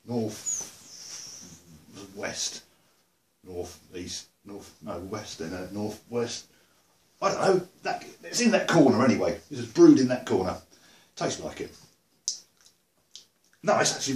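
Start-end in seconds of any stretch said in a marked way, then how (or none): 0.0-6.5 quiet, fumbling over directions; muttering to himself. Real, not acted. 11.1-11.8 unvoiced joking aside; whispered aside. Real, not acted. Aside joke about the beer.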